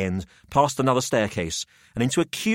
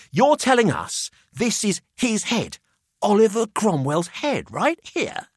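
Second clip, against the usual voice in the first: put-on higher, incredulous young man; can't believe what he's seeing; Jamie amazed; 11 semitones higher, slower than usual. Jamie to Pelham, astonished at the head.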